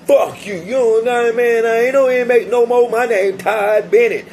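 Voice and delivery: talking trash. Retold or imitated. Freed inmate telling off the guard, high and loud. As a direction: throw it in his face, voice up high. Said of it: "Straight up, I'm talking shit".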